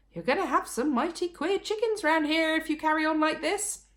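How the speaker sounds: a hint of a nagging wife; sceptical Missus Cranky. A narrator's reading. Missus Cranky doubting the medicine, lightly voiced scepticism.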